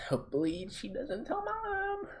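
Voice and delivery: high, anxious little voice; worried squeak. Retold or imitated. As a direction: fret like a scared kid. Scared kid prays.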